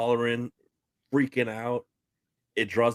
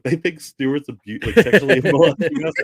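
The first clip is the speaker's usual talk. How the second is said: louder, laughing through the tattle; kids tattling, laughing; 5 semitones higher, 8 dB louder than usual. Retold or imitated. Kids telling the mom, breaking into laughter. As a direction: giggle out the accusation. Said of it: "They're like".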